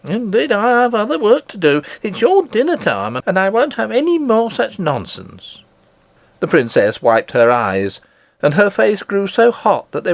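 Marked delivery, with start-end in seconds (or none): 0.1-5.6 higher, firm no-nonsense nurse. Acted. Nurse ending the argument, firm and higher. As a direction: shut the matter down.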